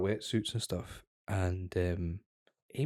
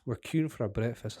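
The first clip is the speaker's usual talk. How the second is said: usual speaking voice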